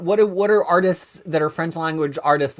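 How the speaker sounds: ordinary talk, nothing marked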